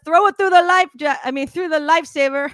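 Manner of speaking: mock resort DJ announcing the game. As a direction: sell the game like a cheesy host. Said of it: "He's like".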